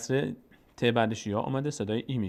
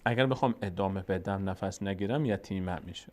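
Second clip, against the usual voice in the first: usual speaking voice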